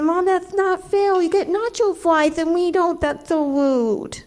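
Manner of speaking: put-on slow, high-pitched child with muddled consonants; little boy's speech impediment. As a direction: complain like a small child who can't say R's. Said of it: "put him in speech therapy".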